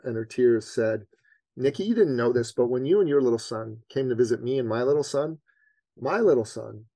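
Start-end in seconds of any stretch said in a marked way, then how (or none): none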